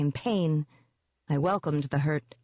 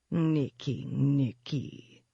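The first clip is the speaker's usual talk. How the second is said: pouting demon; much slower than usual. Acted. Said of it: "the demon pouted".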